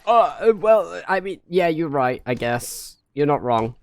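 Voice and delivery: meek British reply. Retold or imitated. Meek Brit agreeing with stereotype.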